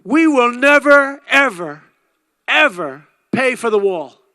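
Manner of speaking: loud, slow, high defiant voice, far from his own; hammed-up foreign leader vowing never to pay; mock Mexican president. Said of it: "He said".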